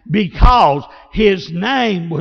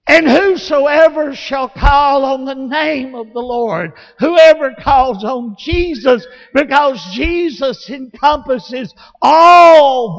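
loud, high, impassioned sermon; full-force preaching; 5 semitones higher, 6 dB louder, slower than usual. Real, not acted. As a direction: drive the point home at the top of your voice.

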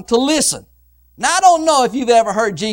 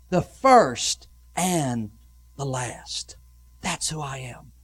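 slow, low, solemn declaration; hushed, weighty divine pronouncement, fading; Jesus Christ; 10 semitones lower, 6 dB quieter, slower than usual. Acted. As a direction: let each phrase sink into a grave hush. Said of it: "the words of Jesus Christ".

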